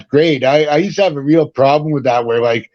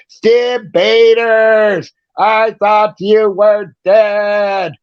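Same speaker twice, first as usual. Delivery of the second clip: much higher, very slow, stretched-out mock voice; imitating an old rocker; 8 semitones higher, much slower than usual. Retelling an old rocker's greeting at a show, in a high, drawn-out mock voice. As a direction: drag it out in a high, goofy put-on voice. Retold quote from [name].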